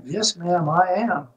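put-on Southern accent, polite reply; playful imitation of a Southern accent; best Southern accent. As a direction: answer like a courteous Southerner. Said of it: "in my best Southern accent".

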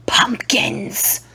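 breathy whisper. Pretend. Vlogger listing fall things in a whisper. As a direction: whisper it dreamily.

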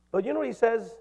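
usual speaking voice

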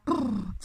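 loud rolled-lip shudder; shivering brrr. Acted. Bear is cold in the car, loud shivering brrr. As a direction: shiver audibly through the lips. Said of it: "it was cold in the car".

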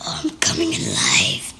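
low whispered creature voice; deep spooky whispered dragon; dragon waking. Pretend. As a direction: breathe it like a dragon stirring awake.

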